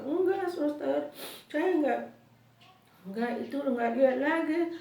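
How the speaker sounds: high, loud, halting insistence; re-enacted urgent denial; insistent protest. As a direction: plead to be believed by the nurse. Acted patient insisting to nurse.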